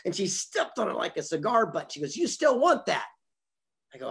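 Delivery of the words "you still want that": pitched way up, acting out her challenge; insistent retold question. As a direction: press the question high and incredulous, as a retold dare. Retelling her insistent repeated challenge.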